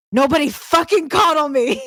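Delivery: just out of surgery, snapping at mom not to coddle her, loud and furious; enraged. Retold or imitated. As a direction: spit it out half-awake and furious. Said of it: "waking up in recovery and being like".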